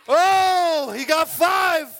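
triumphant shout; excited shout. Real, not acted. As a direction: cheer his score to the crowd. Cheering catcher's result.

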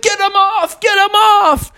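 very high, frantic cartoon squeal; mock panic. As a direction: squeal for help with ants on you. Bear panics under ant attack.

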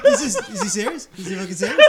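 loud, high laughing disbelief; laughing incredulous. Retold or imitated. Actor reading the script, laughing loudly. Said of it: "he's like".

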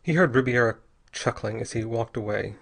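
ordinary delivery, nothing marked

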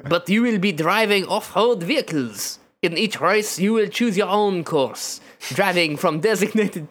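laboured, thick mock French accent; exaggerated French accent. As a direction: explain it as a caricature French official. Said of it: "I would have to say in this accent".

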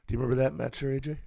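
normal talking voice

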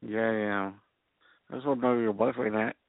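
ordinary talk, nothing marked

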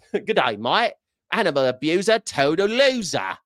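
light mock Australian accent; a hint of Aussie twang, teasing. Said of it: "she says it like an Australian".

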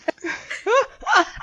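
long high-pitched laugh. Real, not acted. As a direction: let a high laugh ring out. Laughs after her own quote.

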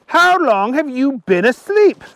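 high, loud, astonished question; incredulous. A narrator's reading. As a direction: ask it astonished, pitched high. Archie in disbelief.

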